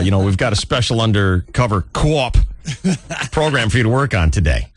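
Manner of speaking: put-on light Boston accent, with a laugh breaking in. Police chief assigning the undercover job, light mock Boston accent. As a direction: keep the mock Boston chief going, cop as co-op. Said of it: "And he's like".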